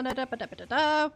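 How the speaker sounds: normal talking voice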